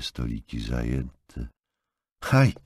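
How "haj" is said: short, high yelp; almost cry out, pitched up; a sudden higher exclamation, just short of a shout; near shout. A narrator's reading. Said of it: "prawie krzyknęła".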